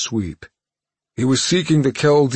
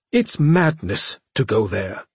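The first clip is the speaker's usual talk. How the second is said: ordinary delivery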